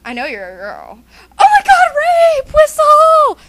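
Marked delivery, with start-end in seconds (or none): 1.4-3.4 high, very loud mock alarm; panicked shout. Alarmed by a sudden come-on, panicked shout. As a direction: shriek for help in hammed-up panic.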